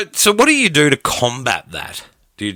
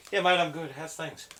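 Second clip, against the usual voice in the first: imitating a mumble, higher and much softer, trailing off; mumbling dad; 4 semitones higher, 13 dB quieter than usual. Son imitates his mumbling dad in the windy garden. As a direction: swallow the words like [name]'s mumbling dad. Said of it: "Is a frickin' mumbler".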